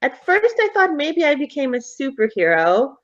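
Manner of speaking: put-on high, boyish explaining voice; chatty ghost boy. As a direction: explain it like an earnest kid.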